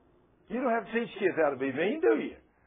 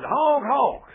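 high, loud mock car horn; imitated honk; 9 semitones higher, 11 dB louder than usual. Preacher honking at the driver with the Jesus sign, loud mock horn. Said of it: "I said".

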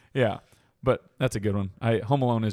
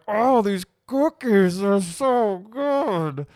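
high, very slow, drawn-out gushing; hammed-up moaning over food, pitched high; smarmy neighbor impression; 9 semitones higher, much slower than usual. Cohost imitating the neighbor guy savoring cookies, exaggerated gushing. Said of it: "the neighbor guy that just thinks".

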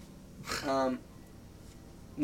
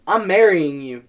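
loud sudden declaration; sleepy proposal; 4 semitones higher, 13 dB louder, slower than usual. Retold or imitated. Rolling over to his ex on the phone, loud blurted proposal.

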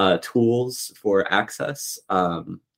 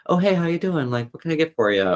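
bright, higher bartender greeting; poetry customer service voice; 9 semitones higher, faster than usual. Pretend. Poet greeting a bar stranger, in customer service voice. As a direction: welcome a stranger at the bar, all friendly service. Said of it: "my poetry customer service voice".

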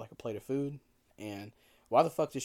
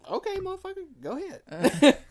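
high, loud sassy dare; cheeky dare thrown at the sergeant; mock defiant; 9 dB louder than usual. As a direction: toss it off cheekily, much higher. Daring the sergeant.

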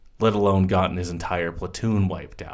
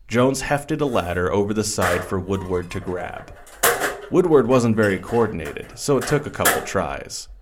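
ordinary delivery

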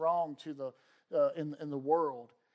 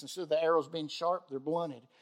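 normal talking voice

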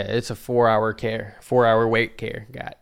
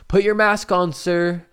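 raised, higher voice of staff; calling out an order; 8 semitones higher, 6 dB louder, slower than usual. Retold or imitated. Staff to vomiting patient, loud acted order. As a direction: imitate staff calling across a room.

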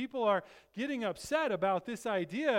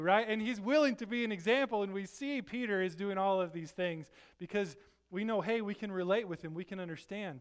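ordinary talk

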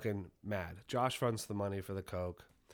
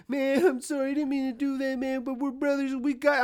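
high, loud whiny blubbering apology; mock crying; 9 dB louder than usual. Storyteller imitates Josh's tearful apology after the punch, mock crying. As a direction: imitate a friend bawling through an apology. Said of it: "and he's crying".

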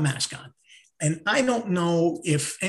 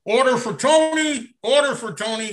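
louder, higher call of order; a counter worker calling out; 6 semitones higher, 5 dB louder than usual. Retold or imitated. Worker calling customer's order, lightly voiced louder call. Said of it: "suddenly you hear".

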